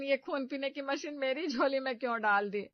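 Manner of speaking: loud, high exasperated wail at the heavens; exasperated lament. Acted. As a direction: curse your luck out loud. Mother-in-law laments to God.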